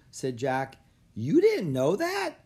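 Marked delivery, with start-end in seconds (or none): 1.1-2.3 loud, slow, very high disbelief; squeaky, flabbergasted kid; astonished question. A narrator's reading. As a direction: draw it out, utterly incredulous. Otis echoes, more astonished.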